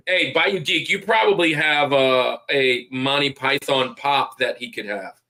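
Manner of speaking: normal talking voice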